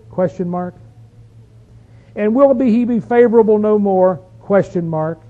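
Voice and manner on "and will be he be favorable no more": scripture reading. Acted. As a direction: proclaim the psalmist's question to the congregation. Reads psalm's questions aloud.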